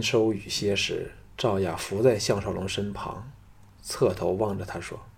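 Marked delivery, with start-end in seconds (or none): none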